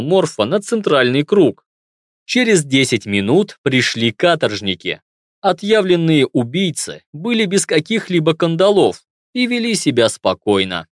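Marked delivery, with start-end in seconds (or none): none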